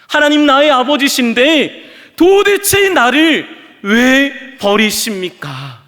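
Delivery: loud, high, slow anguished wail; wailing cry. Acted. Jesus on the cross wails to the Father, loud and slow. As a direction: cry it out in reproach. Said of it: "원망하며 울부짖습니다".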